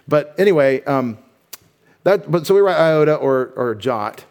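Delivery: ordinary talk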